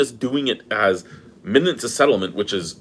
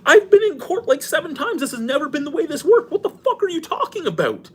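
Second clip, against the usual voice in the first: incredulous outburst. Retold or imitated. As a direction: squeal it in stunned laughing disbelief. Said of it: "I'm like".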